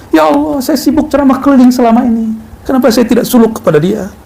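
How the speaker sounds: high, remorseful lament to God; a busy preacher crying out in remorse; regretful plea. Acted. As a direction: wail the regret upward. Preacher regrets neglecting his teacher.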